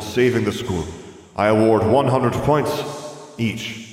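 lightly voiced, declaiming headmaster; formal speech projected to the school; a hint of a ceremonious old headmaster; headmaster's award speech. A narrator's reading.

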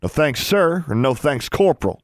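shouted. A narrator's reading. Maximilian correcting Anna's address, shouted. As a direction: correct them like a drill officer. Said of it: "he shouted".

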